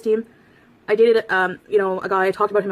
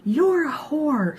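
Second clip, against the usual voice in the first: high, drawn-out mock outrage; flipped-out friend; 8 semitones higher, much slower than usual. Retelling a friend's insult to her, mock outraged. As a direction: imitate an outraged friend, pitched up and slow. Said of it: "My friend flipped her shit".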